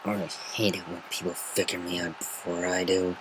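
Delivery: grumbled under the breath; grumbling Rainbow Dash. Acted. Rainbow Dash grumbling at her friend's insight. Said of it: "Rainbow Dash grumbled".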